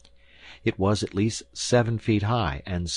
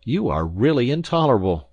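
loud, raised-pitch indignant outburst; said hotly; 6 semitones higher, 7 dB louder than usual. A narrator's reading. Visitor to the mocking professor, hot loud indignation. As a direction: snap it back hotly. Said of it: "said I hotly".

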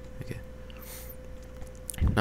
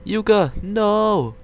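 high, loud cry of alarm; panicked cry; 9 semitones higher, 5 dB louder, much slower than usual. Acted. Sibling crying out as creature nears Yuka, panicked. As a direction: cry out to save her. Monster heads to Yuka's room.

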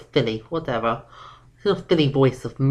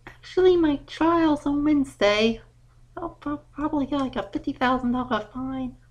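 high, slowing confession of a bigger fine; sheepish filly; 10 semitones higher than usual. Acted. Daughter confesses her upcoming trial to her dad, sheepish. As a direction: admit it reluctantly, dragging it out. Daughter admits more trouble.

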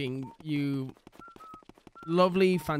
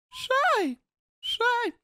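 put-on much higher, sharp old man's "Say!"; Herbert's call. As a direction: call out like a creepy old neighbor. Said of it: "Did you hear her come back".

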